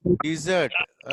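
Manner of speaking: slow "డిజర్ట్", di-ZURT; pronunciation model. Shown as a demonstration. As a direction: say "డిజర్ట్" slowly for the class, weight on ZURT. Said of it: "ఎలా అనాలి?". ("డిజర్ట్" అని నెమ్మదిగా పలికి చూపడం)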